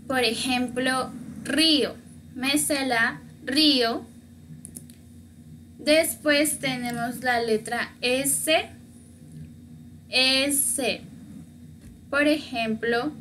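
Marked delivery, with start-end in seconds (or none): none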